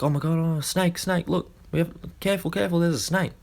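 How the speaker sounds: a man spotting a snake in the road panics; panicked warning. Retold or imitated. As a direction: warn your mate about the snake in a panic. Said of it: "he starts freaking out".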